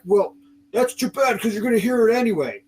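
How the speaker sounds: high, mocking cop voice; hammed-up lecture from a cop; lecturing officer. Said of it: "like, lecturing me".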